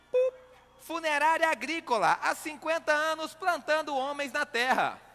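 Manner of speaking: high, slowed sponsor announcer; mock ad read. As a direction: read it like a radio ad.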